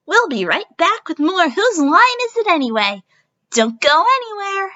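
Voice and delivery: high, bright game show host. Acted. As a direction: sell it to the camera. Host addresses camera.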